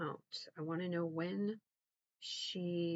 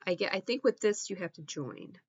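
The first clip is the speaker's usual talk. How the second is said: ordinary talk, nothing marked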